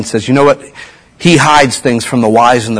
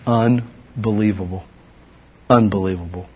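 awed; 3 semitones lower, 9 dB quieter than usual. Real, not acted. Preacher marveling at the water turned to wine, soft. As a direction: let it sink in quietly. Awe at miracle.

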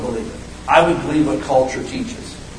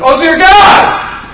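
high, loud and drawn-out terrified wail; panicked cry; 12 semitones higher, 10 dB louder, much slower than usual. Retold or imitated. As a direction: wail it slowly in sudden panic. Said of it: "They're like".

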